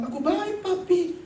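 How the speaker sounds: put-on high, chirpy child voice; imitating a child's high-pitched reply; child's answer. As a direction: answer daddy like a little kid. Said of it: "Dan waktu dia bilang".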